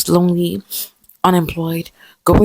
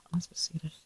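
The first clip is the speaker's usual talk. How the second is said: whisper; 19 dB quieter, much faster than usual. Real, not acted.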